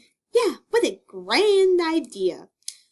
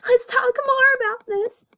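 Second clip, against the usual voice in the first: tearful plea; 13 semitones higher than usual. Acted. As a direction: wail after him not to go. Judy begs Nick to stay.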